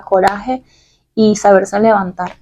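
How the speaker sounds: ordinary talk, nothing marked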